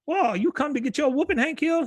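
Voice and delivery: mock Khan taunt. Khan taunts Hank at the stick fights, high and sneering. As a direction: sneer it like a cocky rival.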